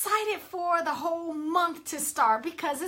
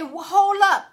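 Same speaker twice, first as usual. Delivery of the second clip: louder, stern call to halt; firm self-check; 4 semitones higher, 9 dB louder than usual. Retold or imitated. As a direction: pull yourself up short. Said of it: "and say".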